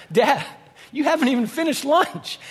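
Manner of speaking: high, loud mock disbelief; hammed-up disbelief at dad; incredulous son. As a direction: protest like an exasperated son. Son's reply, incredulous.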